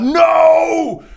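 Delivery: high, loud howled no; villain howling. Acted. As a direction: howl the no with fury. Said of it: "Murder Machine howling out".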